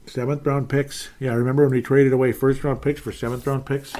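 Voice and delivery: ordinary delivery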